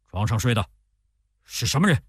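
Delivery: mock-groggy question rising at the end; feigned sudden waking. A narrator's reading. General pretending to wake and not know his bedmate, feigned confusion. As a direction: ask as if just jolted from sleep. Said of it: "装作梦中忽然醒来的样子".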